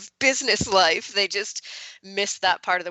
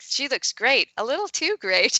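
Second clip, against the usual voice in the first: higher, lightly teasing compliment; a compliment with a wink at the end; mock polite feedback; 7 semitones higher than usual. Author quoting her cover note, lightly teasing. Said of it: "with the first cover was like".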